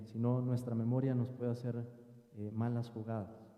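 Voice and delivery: ordinary talk, nothing marked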